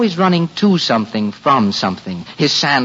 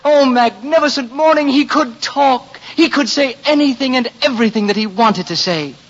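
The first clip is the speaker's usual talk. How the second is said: raised pitch, elated storyteller; exultant wonder; 9 semitones higher than usual. A narrator's reading. Narrator exults that the boy could talk, elated. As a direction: rejoice as if the miracle has happened.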